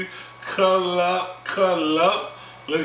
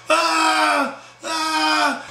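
high, drawn-out "ah", twice; mock scream; 9 semitones higher than usual. Storyteller mimics Brian's girl at the fight, mock scream. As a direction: imitate a screaming woman with long high wails. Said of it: "Sophia screaming".

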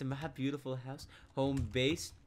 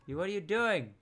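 startled challenge; 5 semitones higher, 4 dB louder than usual. Real, not acted. Challenges another player, first take.

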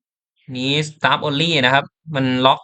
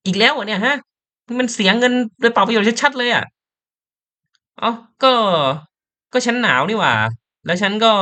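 higher, nagging and complaining; nagging character voice; 8 semitones higher than usual. Acted. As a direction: whine like a nagging mother. Voicing Ashley nagging Andrew. (เสียงสูงบ่นจู้จี้เหมือนแม่)

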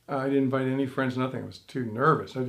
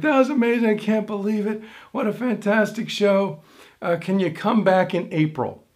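higher-pitched, excited booker; gushing praise; 4 semitones higher than usual. Retold or imitated. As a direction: imitate a booker gushing after the show, pitched up. Booker gushing after the show.

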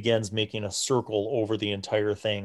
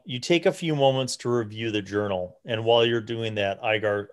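ordinary talk

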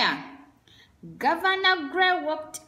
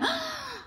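sharp gasp; 6 dB quieter than usual. Acted. Narrator gasps at sudden noise.

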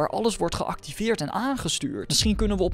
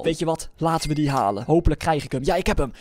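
ordinary delivery

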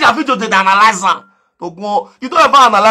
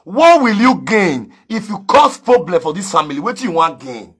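normal talking voice

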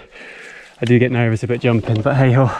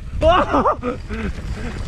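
excited laugh; 11 semitones higher than usual. Real, not acted. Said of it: "That noise is the noise I make".

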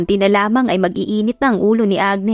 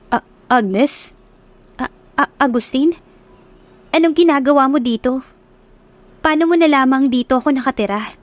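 stuttering, higher, caught off guard; shocked stammer; 4 semitones higher than usual. Acted. Agnes finding Agustin at her door, stammering in surprise. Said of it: "Gulat na gulat silang dalawa".

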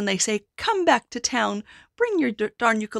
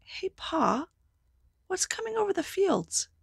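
put-on high childlike voice; little boy; 11 semitones higher than usual. The boy asking his father about the giant. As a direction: ask like a curious small child. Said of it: "boy woke up".

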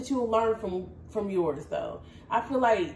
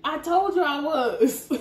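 higher, drawn-out tipsy admission with a laugh; 6 semitones higher, slower than usual. Real, not acted. Co-host admits she is drunk on the show, tipsy laughing. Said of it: "You drunk like that?".

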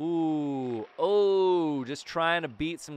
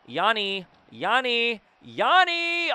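calling out; 8 semitones higher, 5 dB louder, slower than usual. Real, not acted. Calling teammate for pass.

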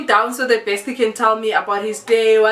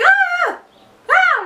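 very high mock scream; two short high-pitched cries; imitated screaming; 4 dB louder than usual. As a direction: mimic screams heard from another room. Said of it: "I can hear some screaming".

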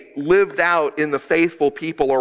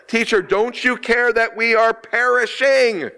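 desperate plea; 10 semitones higher than usual. Acted. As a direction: plead with the teacher as if all is lost. Disciples' desperate plea in storm.

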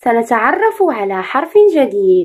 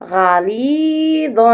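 غليظ said again, very slow and drawn out; slow repeat; much slower than usual. Shown as a demonstration. Teacher repeats the word slowly after asking pupils to repeat. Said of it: "رددوا".

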